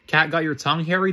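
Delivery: usual speaking voice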